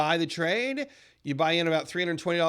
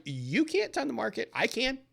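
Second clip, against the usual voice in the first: laughing back; 7 semitones higher, 3 dB quieter than usual. Retold or imitated. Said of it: "I laugh and I'm like".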